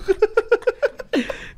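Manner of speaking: high-pitched real cackling laugh; high laughter. Real, not acted. Friends laughing at the robber's anger, high laugh. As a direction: crack up at the absurdity.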